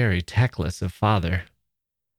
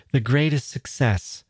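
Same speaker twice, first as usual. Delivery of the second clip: ordinary delivery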